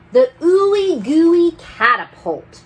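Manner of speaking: high, loud burst; excited exclaim. A narrator's reading. Storyteller announcing the catapult, excited and loud. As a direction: shout it out with glee.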